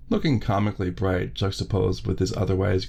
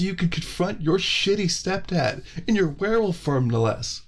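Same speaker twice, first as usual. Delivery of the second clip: much higher, slow, starstruck woman's voice; gushing Heather; 10 semitones higher, slower than usual. Acted. Wife Heather praising Dom as a legend, high and starstruck. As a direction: gush at him like he's a celebrity.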